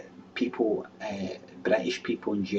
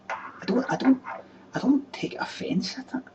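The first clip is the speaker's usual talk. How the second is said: squeaky-high, half-whispered protest; high-pitched protest; 13 semitones higher than usual. Acted. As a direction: push the voice way up high. Mimics own protest, pitched way up.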